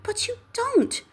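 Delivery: high, slow gentle rebuke; gentle nephew. Acted. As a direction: nephew mildly correcting his uncle.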